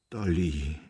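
low, tremulous plea; trembling voice. A narrator's reading. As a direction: repeat the name with a tremble. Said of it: "wiederholte er mit zitternder Stimme". (mit zitternder Stimme)

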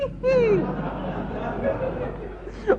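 long, high, soft giggle; helpless laughter at his vanity; amused giggle. Acted. Amused that he wants a burglary.

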